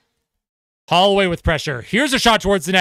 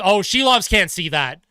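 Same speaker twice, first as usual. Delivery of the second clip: ordinary delivery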